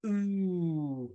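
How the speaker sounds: soft rounded ooh; teacher modelling the vowel ooh; ooh demo. Shown as a demonstration. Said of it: "Once more".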